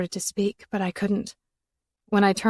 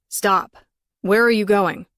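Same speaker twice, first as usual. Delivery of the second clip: raised voice, calling her back; a touch louder, urgent; man calls after fleeing woman, urgent; 2 semitones higher, 5 dB louder than usual. A narrator's reading. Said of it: "He chased me down the hallway".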